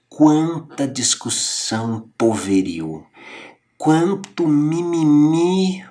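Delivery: mocking exasperation. Mocking petty squabbles.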